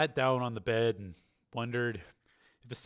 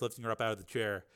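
ordinary delivery

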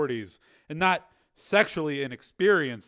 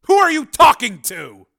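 demanding; 12 semitones higher, 16 dB louder than usual. A narrator's reading. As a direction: burst in and demand answers. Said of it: "Samuels demanded".

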